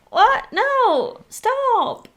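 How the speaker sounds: high, outraged squeal; exasperated disbelief. Retold or imitated. Exasperated reaction.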